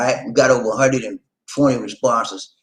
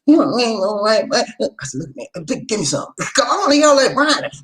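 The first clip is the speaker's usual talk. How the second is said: high, louder imitation of the wife; high mock wife voice; 12 semitones higher, 3 dB louder than usual. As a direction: mimic her scolding. Wife's voice quoted.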